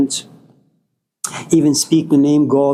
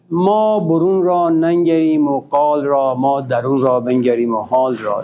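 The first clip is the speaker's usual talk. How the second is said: quick, rhythmic Persian verse recited from memory; poem recitation; 2 semitones lower, much faster than usual. Acted. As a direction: roll the Rumi couplet out like a well-known poem. Recites Rumi couplet.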